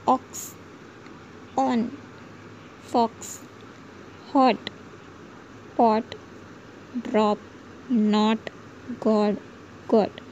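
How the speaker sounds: brief open ऑ in words like ऑक्स, हॉट, गॉट; short o. Shown as a demonstration. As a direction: say each word singly, keeping the ऑ short. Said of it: "ओ का ह्रस्व उच्चारण". (ऑक्स, हॉट, गॉट जैसे शब्दों में ह्रस्व ऑ)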